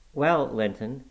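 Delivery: ordinary delivery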